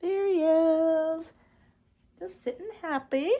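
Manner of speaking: high, slow, sing-song there he is; cooing reveal. Real, not acted. Crafter shows viewers her starfish, slow cooing reveal. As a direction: present him like a proud parent. Presenting the big plush.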